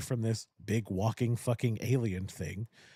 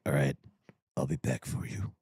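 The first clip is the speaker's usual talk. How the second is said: much lower, deep movie-hero promise; hammed-up deep hero voice; gruff action hero; 11 semitones lower than usual. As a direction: drop way down like a stoic action star. Voicing the hero in a movie recap.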